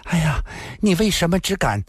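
high, breathless seductive woman's voice; moaning, panting. Acted. Lin Xian'er goads Yiku, high and moaning. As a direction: moan the taunt up high. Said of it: "呻吟地喘息道".

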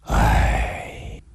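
low, drawn-out sigh; long sigh. A narrator's reading. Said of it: "长长叹了口气".

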